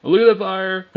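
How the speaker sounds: high, loud astonished call; real thrill at a wild price spike; excited shout. Real, not acted. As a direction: shout it as the chart explodes. Sudden market spike on screen.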